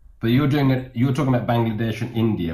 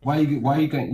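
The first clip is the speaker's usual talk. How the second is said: ordinary talk, nothing marked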